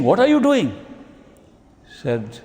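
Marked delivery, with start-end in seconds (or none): 0.0-0.7 high, louder scolding question; stern alarm. Acted. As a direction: confront him, shocked at the sight. Master confronts Ishwar Singh.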